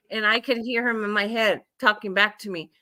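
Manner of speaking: usual speaking voice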